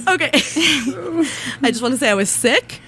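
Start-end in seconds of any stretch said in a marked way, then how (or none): 0.3-1.6 high giggle of embarrassment; embarrassed laugh. Real, not acted. Embarrassed at her memo.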